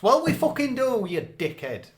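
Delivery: sudden high, loud rant; exaggerated outrage at a director; mock angry outburst. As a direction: snap back at the director in mock fury. Angry retort to imagined director.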